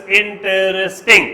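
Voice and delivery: انټرسټینګ slowly split as ان-ټر-س-ټینګ; syllable by syllable. Shown as a demonstration. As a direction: spell out the beats of انټرسټینګ, unhurried. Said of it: "څلور دي". (انټرسټینګ ورو، سیلیبل په سیلیبل)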